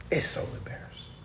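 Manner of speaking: whispered, soft aside in disbelief; dismayed whisper. Real, not acted. Streamer dismayed at Kamala's accents, whispering how embarrassing. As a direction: whisper it, mortified.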